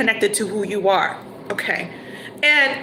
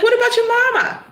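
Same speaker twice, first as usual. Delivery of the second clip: pointed question; 12 semitones higher than usual. Acted. Girlfriend pressing the boyfriend.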